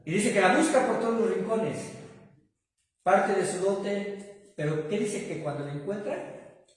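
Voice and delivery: loud, emphatic preacher's voice; preaching at full force. Acted. Pastor to congregation, loud emphatic preaching. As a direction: pastor retelling the lost coin parable, preaching loudly.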